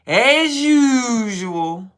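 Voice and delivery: loud, slow, high singsong; drawn-out mock. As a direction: stretch it out sarcastically. Mocking the kid's bullshitting.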